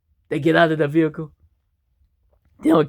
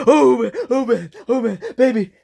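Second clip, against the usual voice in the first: panicked; 8 semitones higher than usual. Acted. Husband fearing the cliff, high panicked voice. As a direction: panic as the car races downhill.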